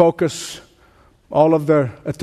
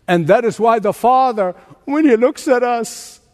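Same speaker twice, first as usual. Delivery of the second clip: higher, ringing pulpit preaching; full-force preaching; 6 semitones higher than usual. Real, not acted. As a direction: lift the congregation with a fervent sermon voice.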